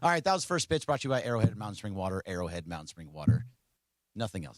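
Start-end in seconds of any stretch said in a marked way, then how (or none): 0.4-3.5 quick, low ad read; sponsor read. Acted. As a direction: rattle off the sponsor line. Reads sponsor tag.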